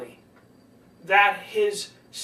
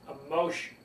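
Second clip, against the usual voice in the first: slow, emotional, with weighty pauses; slow right down and let the feeling sit; soft and slow; 5 semitones lower, 6 dB quieter than usual. Shown as a demonstration. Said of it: "soft and slow creates emotion".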